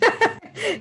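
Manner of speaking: bright burst of laughter; short laugh. Real, not acted. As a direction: laugh it off lightly. Laughs at the compliment.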